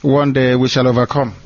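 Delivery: ordinary talk, nothing marked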